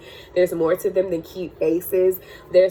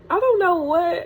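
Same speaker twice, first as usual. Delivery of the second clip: a real catch of tears in the voice; words breaking as the tears come; tearful voice; 11 semitones higher than usual. Real, not acted. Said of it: "Sorry for getting all emotional".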